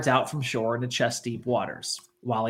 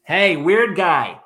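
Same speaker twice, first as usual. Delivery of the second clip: warning call; 4 semitones higher than usual. Retold or imitated. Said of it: "They were like".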